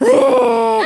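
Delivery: loud, high, drawn-out disbelief; surprised outburst. Real, not acted. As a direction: react in high, loud amazement. 意外な話への反応. (大きく高い驚きの声)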